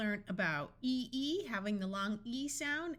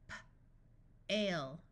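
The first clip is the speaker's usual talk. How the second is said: puffed P, pause, then "ale"; sounded out; 2 semitones lower, faster than usual. Shown as a demonstration. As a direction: break "P-, ale" into separate sounds. Phonics teacher sounds out, then blends.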